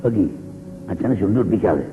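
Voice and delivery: deep father giving a wedding gift; stern father. Acted. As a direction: speak down as the gruff father. Father voiced sending daughter off.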